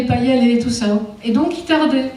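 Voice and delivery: normal talking voice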